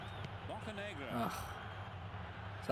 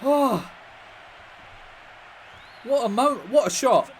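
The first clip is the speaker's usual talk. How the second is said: excited; 7 semitones higher, 4 dB louder, slower than usual. Real, not acted. Marvelling at the goal.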